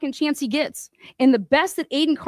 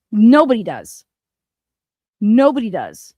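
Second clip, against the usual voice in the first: loud flat declaration; angry final word in a rant; 4 semitones lower, 5 dB louder than usual. Real, not acted. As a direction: slam it down firmly.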